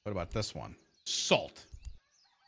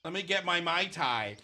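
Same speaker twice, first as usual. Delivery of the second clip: mocking valley girl; 5 semitones higher than usual. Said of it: "She's like".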